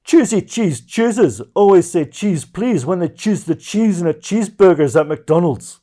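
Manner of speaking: a loud, high put-on Scottish tongue-twister; mock Scottish recital, high and loud; Scottish accent. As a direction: rattle it off in a hammed Scottish accent. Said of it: "you didn't need to use the Scottish accent".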